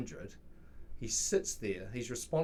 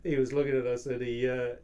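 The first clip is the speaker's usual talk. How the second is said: normal talking voice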